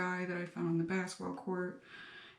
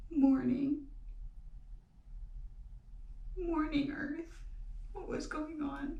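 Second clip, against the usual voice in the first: high, tearful halting voice; choked up; 7 semitones higher than usual. Real, not acted. Telling viewers about mourning Earth, choked up. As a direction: fight back real tears while speaking. Choking up recalling grief over bushfires.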